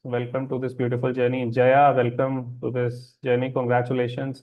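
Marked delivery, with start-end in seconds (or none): none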